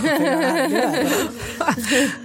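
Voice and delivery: high, amused laugh in the words; laughing through words. Real, not acted. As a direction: laugh as you boast you can do it. Boasting to nurse, laughing.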